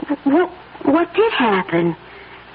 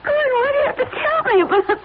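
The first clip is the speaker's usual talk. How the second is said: high, tearful protest breaking into sobs; crying; 10 semitones higher, faster than usual. Acted. Wife sobbing at her husband's confession, high and tearful. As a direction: cry out the hurt of hearing it. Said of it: "Please don't cry".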